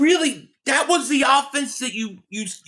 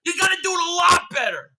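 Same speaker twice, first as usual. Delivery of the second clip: mocking shout; 7 semitones higher, faster than usual. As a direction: ham up the fan's yelling impatience. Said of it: "He goes".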